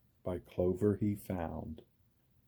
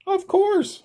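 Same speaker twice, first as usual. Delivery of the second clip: much higher, louder reassurance; eager answer; 6 dB louder than usual. A narrator's reading. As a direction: answer at once, high and keen. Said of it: "Horton answered".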